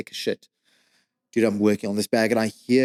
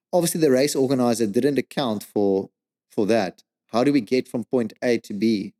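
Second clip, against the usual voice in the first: ordinary talk, nothing marked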